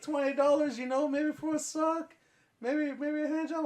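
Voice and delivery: high, loud haggling voice; coaxing high-pitched sales pitch; meth head voice. Retold or imitated. Retelling the stranger's price to friends, in a high coaxing voice. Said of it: "the voice sounds like a meth head".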